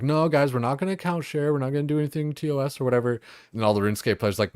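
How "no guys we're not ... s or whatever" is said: high, fast goody-goody protest; squeaky rule-follower voice; pitched up, rushed mock insistence; mock earnest refusal. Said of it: "we were all like".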